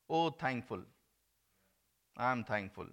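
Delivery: normal talking voice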